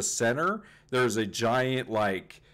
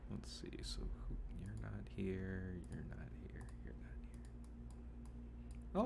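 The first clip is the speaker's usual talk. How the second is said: muttering to self; 17 dB quieter, faster than usual. Real, not acted. GM mutters while checking the map.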